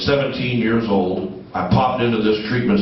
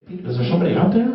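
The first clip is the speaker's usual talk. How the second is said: mock puzzled thought; 7 semitones higher, faster than usual. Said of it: "And I thought to myself".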